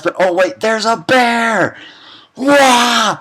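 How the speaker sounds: mock panic. Mock panic at bear appearing.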